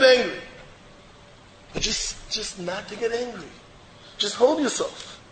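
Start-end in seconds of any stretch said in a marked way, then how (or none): 1.7-5.2 soft, unvoiced start; whispered advice. Real, not acted. Rabbi advising listener to hold back, soft whisper. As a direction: hush it out gently.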